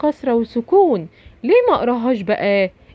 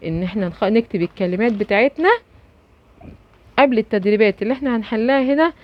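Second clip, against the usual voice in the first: normal talking voice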